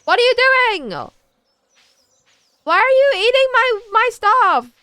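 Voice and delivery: squeaky high, loud telling-off; high-pitched scolding. Real, not acted. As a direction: shriek at the naughty pet. Scolding the game pet.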